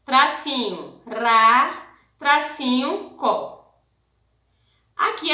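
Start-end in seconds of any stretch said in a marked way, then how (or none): 0.0-3.8 put-on slow, carefully split syllables for children; teacher dictating each syllable slowly; syllable-by-syllable dictation. Shown as a demonstration. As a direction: spell it out one beat at a time. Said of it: "Vamos escrever pedacinho K".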